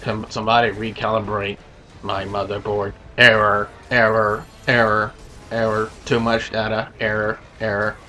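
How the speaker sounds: low, soft, monotone robot drone; mock him as a droning robot; Roman Reigns robot voice. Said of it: "This is Roman Reigns talking".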